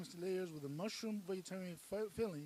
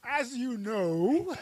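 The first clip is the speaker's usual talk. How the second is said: laughing; 7 semitones higher, 14 dB louder, much slower than usual. Real, not acted. Laughing at the absurd plan.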